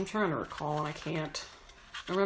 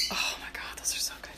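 whisper; 7 dB quieter, faster than usual. Real, not acted. Whispering about lard chips.

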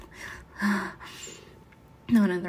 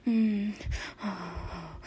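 ordinary delivery

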